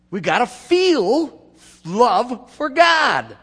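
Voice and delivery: mock emotional yearning. Preacher mocking people who try to feel love, in a slow gushing voice. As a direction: poke fun at forced feelings by overdoing them. Mocking churchgoers chasing a feeling.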